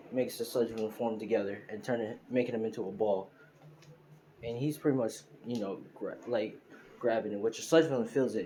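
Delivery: ordinary delivery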